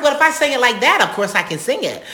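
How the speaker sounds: high, loud indignant comeback; loud protest. Retold or imitated. Student protesting to her opera teacher, loud and high. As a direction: argue back loudly, half laughing.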